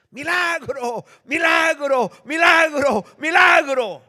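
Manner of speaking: high, very loud ecstatic cries; crazed shouting. Acted. Preacher acts out rescued ant proclaiming a miracle, crazed high shouting. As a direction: scream it over and over like mad, each cry building. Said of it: "empezó a gritar como loca".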